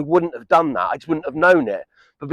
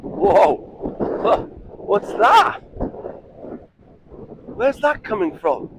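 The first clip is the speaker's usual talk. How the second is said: wind in the face; 10 semitones higher, slower than usual. Real, not acted. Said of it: "it's super windy, so I'm sure the microphone's picking up some wind".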